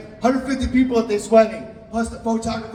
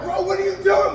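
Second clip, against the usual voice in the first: mock indignant yell; 11 semitones higher than usual. Groom shouting at the DJ sweeping up money. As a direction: squeal it at the thief.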